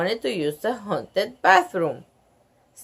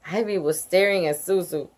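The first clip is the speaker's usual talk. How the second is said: usual speaking voice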